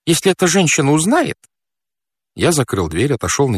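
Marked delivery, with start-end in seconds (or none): 0.1-1.4 high, worried character voice; flustered fat man. Acted. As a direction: fret in a raised pitch. Fat man dreads being discovered. (встревоженный толстяк, высоким голосом)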